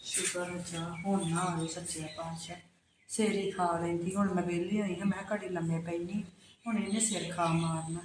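low, soft grumbling to herself; muttered aside. Acted. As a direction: grumble under your breath. Muttering aside after neighbor leaves.

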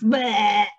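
low mock burp; burp sound. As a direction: blurt a silly burp.

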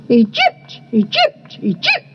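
squeaky chirps leaping very high; honeyeaters. A narrator's reading. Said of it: "said the honey eaters".

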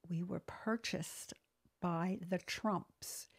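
slow, hushed retelling, dropping toward a whisper; low confidential voice. Retold or imitated. Purchasing agent admitting who bought the place, low and hushed. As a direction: draw it out softly, like an awkward secret. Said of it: "don't have to say that so low".

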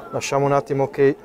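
usual speaking voice

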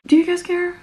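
cheeky question to the audience; playful ask. Real, not acted. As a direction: tease the viewers. Teasing question to viewers.

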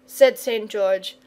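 ordinary delivery, nothing marked

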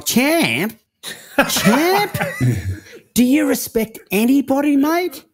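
incredulous high squeak, laughing; high-pitched outraged impression of the coach; mock-indignant squeal breaking into laughter; mocking Eddie. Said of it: "He's gone".

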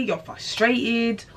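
ordinary talk

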